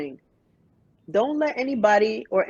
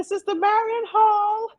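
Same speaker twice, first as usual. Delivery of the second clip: high, excited fan gush; high starstruck greeting; 13 semitones higher, 5 dB louder than usual. Retold or imitated. Fangirling over singer backstage.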